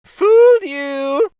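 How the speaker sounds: very high, loud, slow triumphant shout; spring the joke. A narrator's reading. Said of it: "cried King Kenric".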